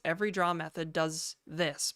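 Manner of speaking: ordinary talk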